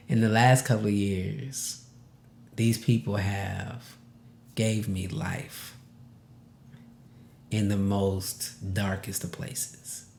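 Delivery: quiet and close to crying; holding back tears. Real, not acted. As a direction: fight the tears as you say it. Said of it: "okay, I'm gonna try not to cry".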